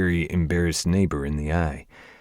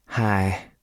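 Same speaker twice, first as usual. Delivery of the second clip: light southern drawl on a shy hi; southern accent; 3 semitones higher than usual. Acted. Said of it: "her southern accent thicker than honey".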